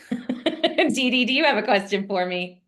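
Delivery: normal talking voice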